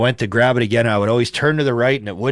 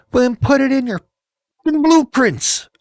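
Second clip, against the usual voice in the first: going off in a rant; 13 semitones higher than usual. Retold or imitated. Griping builder snaps back at engineers, high angry outburst. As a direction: vent it as a furious retort, pitched way up. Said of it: "I had to go off on that".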